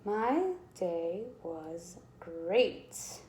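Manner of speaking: each word drawn out very slowly and softly; teacher writing the sentence out; slow stretched dictation. Shown as a demonstration. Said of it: "use an exclamation point".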